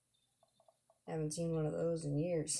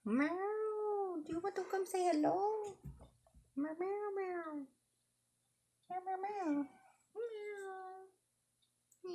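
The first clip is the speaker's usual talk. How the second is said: cat voice. Acted. Meowing and calling to a cat.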